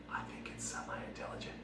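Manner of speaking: breathy secret whisper, no voice. Acted. The tofu whispering to the crowd, unvoiced whisper. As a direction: hiss it like a sneaky aside.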